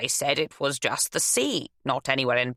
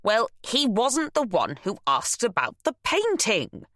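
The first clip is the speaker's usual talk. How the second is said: firm, higher insistence; insisting; 8 semitones higher than usual. Acted. Nell insists to her son, firm. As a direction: stand your ground. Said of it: "Nell insisted".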